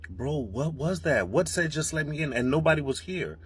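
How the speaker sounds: shocked. Real, not acted. Baffled by voice in empty house.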